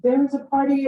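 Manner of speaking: a hint of a crying teen; mock wailing. Daughter on the plane call, mock wailing. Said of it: "wailing, crying".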